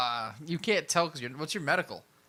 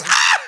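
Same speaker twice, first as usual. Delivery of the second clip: very high, loud scream; death scream; 15 dB louder than usual. Acted. Game master as the wife shot while running, piercing scream. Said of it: "And she just drops".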